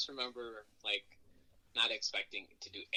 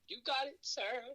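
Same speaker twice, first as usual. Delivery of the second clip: high, chirpy yes-sir reply; mock eager soldier; slower than usual. Answering Goldberg's instructions in a mock eager yes-sir voice. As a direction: play the overeager recruit.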